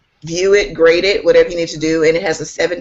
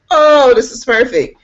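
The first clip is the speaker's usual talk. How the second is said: higher, louder gush of delight; delighted reaction; 7 semitones higher, 5 dB louder than usual. Retold or imitated. Teacher retelling the invitation to be an advocate, delighted. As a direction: gush it, recreating your own delight. Acted reaction to being asked.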